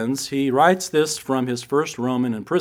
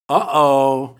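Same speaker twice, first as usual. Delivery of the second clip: put-on a loud, laughing toddler's uh-oh; mock toddler's loud uh-oh; loud kid's uh-oh; 6 dB louder than usual. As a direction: blurt it out like a little boy. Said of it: "real loud, he goes".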